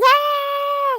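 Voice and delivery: sudden high startled yell; scared scream. Retold or imitated. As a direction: shriek like on a roller coaster. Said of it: "I was like".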